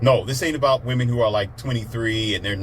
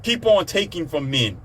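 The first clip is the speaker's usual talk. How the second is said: higher-pitched mock government voice; imitated government; 6 semitones higher than usual. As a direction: lightly parody an official handing out favors. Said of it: "the government's like".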